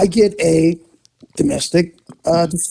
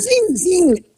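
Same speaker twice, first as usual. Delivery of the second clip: high zing zing whoosh; little car zing sound, pitched up; imitated zinging car; 13 semitones higher than usual. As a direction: squeak it like a toy car racing past. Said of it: "he goes".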